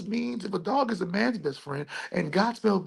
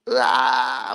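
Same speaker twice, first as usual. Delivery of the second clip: sudden loud low cry of alarm; mock cry out; 8 semitones lower, 4 dB louder than usual. Guru catches himself revealing too much, loud mock cry. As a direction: yelp like you caught yourself.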